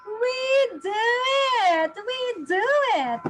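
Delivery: high, loud, slow cheer; big praise for the reader; excited cheer. Real, not acted. Cheering child on.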